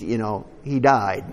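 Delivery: ordinary talk, nothing marked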